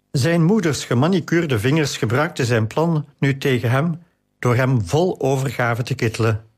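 plain reading voice

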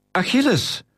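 high-pitched call with a falling end; roepen. A narrator's reading. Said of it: "riep Titus weer". (hoge roep, dalend aan het eind)

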